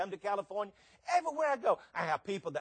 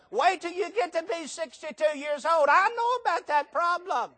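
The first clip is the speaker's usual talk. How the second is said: high, raised voice of knowing exasperation; comic outburst; 10 semitones higher, 5 dB louder than usual. Real, not acted. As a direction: burst out high, insisting you know firsthand. Comic outburst about his aging knees.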